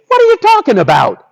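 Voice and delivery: high, quick incredulous question. Acted. Storyteller gives children the villagers' reply, incredulous. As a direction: toss it out in baffled disbelief.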